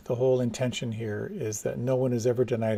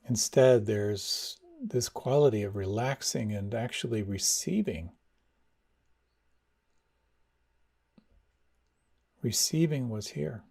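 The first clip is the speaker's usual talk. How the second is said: guided meditation. Acted. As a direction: ease them inward, unhurried. Leads a meditation.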